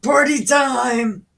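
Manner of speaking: high, loud whoop; party shout. Retold or imitated. Absalom kicking off the feast with a loud shout. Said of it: "Absalom was like".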